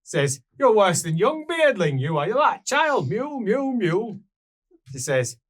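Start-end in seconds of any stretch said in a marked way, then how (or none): 0.6-4.2 higher, louder teasing scold; lightly voiced dwarf chiding a stubborn youth. Dwarf woman calling the adventurer a mule, teasing.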